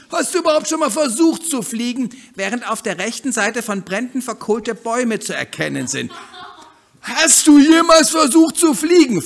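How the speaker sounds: high, loud, bubbly hen voice; lively bossy hen. Acted. Hen eager to leave the backyard, loud and high. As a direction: push ahead full of cheer.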